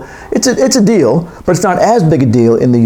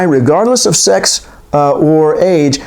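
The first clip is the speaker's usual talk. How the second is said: ordinary delivery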